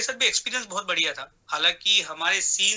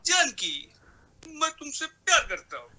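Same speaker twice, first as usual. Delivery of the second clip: high-pitched, sweet film-hero impression; Jeetendra; 9 semitones higher than usual. Mimic shows an actor's love line, sweet high Jeetendra impression. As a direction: sweetly declare love in a much higher voice. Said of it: "या फिर जितेंद्र जी जो".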